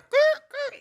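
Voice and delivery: high mechanical squeaky growl; imitated car hydraulics. Joking about the car's hydraulics, imitated high noise.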